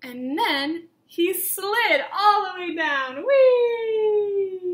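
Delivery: gleeful slide. A narrator's reading. Teacher reading to children, joyful slide down the hill. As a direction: ride the slide down with delight. Sliding down the snow mountain.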